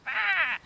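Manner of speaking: high loud screech; crocodile screech. A narrator's reading. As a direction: screech like a monster. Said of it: "And the crocodile screeched".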